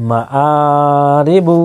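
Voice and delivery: আ held long, then short রি বু; drawn-out vowel. Shown as a demonstration. Teacher showing khara zabar, the আ drawn out. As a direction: stretch the আ, keep মা, রি and বু short. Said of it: "টানতে হবে।".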